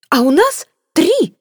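mother shrieking back at the suitor, hysterical and loud; hysterical shout. Acted. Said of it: "истерически выкрикнула миссис Флип".

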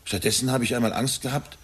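ordinary talk, nothing marked

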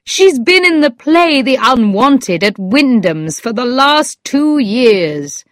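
mildly insistent cry; exclaiming. A narrator's reading. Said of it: "I cried".

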